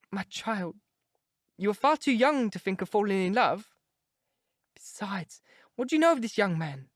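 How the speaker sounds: lightly voiced actress mother fretting; fussy stage mother. A narrator's reading.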